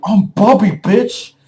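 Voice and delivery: loud, high, acted-out taunt; brash announcement. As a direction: declare it loudly at a high pitch, mock-menacing. Beast man announces himself in quarrel.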